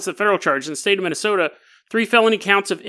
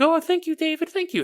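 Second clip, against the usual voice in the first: much higher, softer, gushing thanks; sweet girls thanking their supposed hero; grateful girls; 11 semitones higher, 5 dB quieter than usual. Retold or imitated. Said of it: "they're like".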